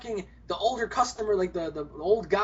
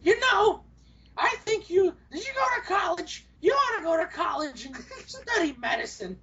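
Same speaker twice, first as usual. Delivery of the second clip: bossy granny; 9 semitones higher than usual. Retold or imitated. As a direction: play a pushy granny lecturing, high. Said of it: "She's like".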